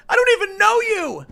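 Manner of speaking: very high, loud panicked squeal; shrill frightened protest; terrified plea. Acted. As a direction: beg for your life in a high voice. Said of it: "And the Netflix guy goes".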